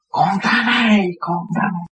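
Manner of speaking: a hint of an old man crying for joy; crying reunion cry. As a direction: retell the old priest sobbing out his joy. Old priest sobbing on reunion.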